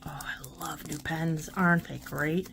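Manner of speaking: a soft breathy whisper to herself; whispered aside. Real, not acted. Whispering to viewers about loving new pens. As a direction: whisper it like a private delight.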